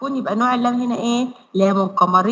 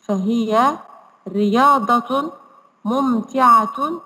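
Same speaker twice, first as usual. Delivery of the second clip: put-on slow syllable-by-syllable reading for children; slow model reading; slower than usual. Shown as a demonstration. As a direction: read each word slowly so the kids can repeat. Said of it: "اقرأوا معايا وقولوا معايا". (قراءة بطيئة واضحة للأطفال)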